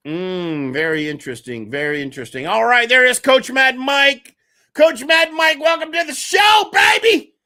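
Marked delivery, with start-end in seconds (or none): 2.5-7.3 higher, louder hype welcome; host welcoming the coach on air, loud and excited. Real, not acted. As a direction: hype the guest up as he joins. Host welcomes guest Coach Mad Mike.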